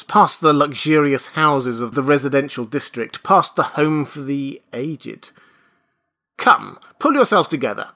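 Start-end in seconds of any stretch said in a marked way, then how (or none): 6.4-7.9 brisk, louder order; commanding. A narrator's reading. Nurse orders the father, commanding. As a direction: snap him out of it. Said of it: "commanded the nurse".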